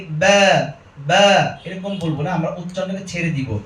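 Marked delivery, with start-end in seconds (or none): 0.1-1.6 "ব্যা" with the vowel halfway between a and e; vowel kept middling. Shown as a demonstration. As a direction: hold "ব্যা" in the middle, not released upward. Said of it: "অত মাঝখানে এনে বলি".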